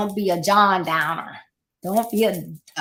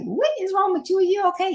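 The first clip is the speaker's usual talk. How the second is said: startled concern; 11 semitones higher, faster than usual. Retold or imitated. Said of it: "somebody's like".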